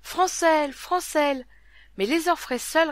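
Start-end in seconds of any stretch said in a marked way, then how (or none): 0.0-1.5 high, loud desperate call; heartrending cry. A narrator's reading. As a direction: cry out his name in anguish. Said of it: "d'une voix déchirante".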